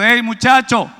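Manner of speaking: woman calling after the preacher from a distance, lightly called; calling from afar. Retold or imitated. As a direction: call out to someone leaving. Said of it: "alguien dice de lejos".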